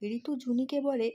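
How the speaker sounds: usual speaking voice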